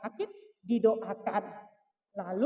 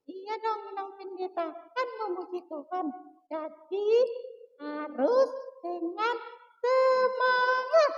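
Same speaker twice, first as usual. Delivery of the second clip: put-on child puppet voice; 6 semitones higher than usual. Puppet child Toba answers pastor.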